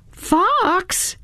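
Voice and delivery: calling out. A narrator's reading. Calling Fox who will not stand, calling out. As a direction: call a name in a high, held note.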